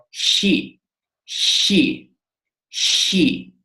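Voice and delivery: 'she' begun with a soft loch-like 'ch'; soft Greek chi sound. Shown as a demonstration. Greek teacher demonstrates the letter chi, soft loch-like sound. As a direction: say 'she' with a gentle palatal rasp in place of 'sh'. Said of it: "that sound but a bit softer".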